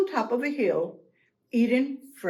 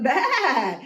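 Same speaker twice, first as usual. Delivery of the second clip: long, loud baa; startled goat bleat; 5 dB louder than usual. Acted. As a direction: bleat in alarm.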